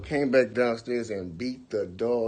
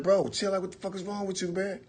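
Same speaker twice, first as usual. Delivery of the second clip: higher, tense and pleading; nervous; 9 semitones higher than usual. Retold or imitated. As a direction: urge him to stop, scared. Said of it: "I'm like".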